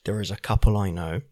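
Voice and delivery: low, hushed near-whisper; hushed confiding. Real, not acted. Podcaster confiding about a couple living nearby, hushed. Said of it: "no need to whisper".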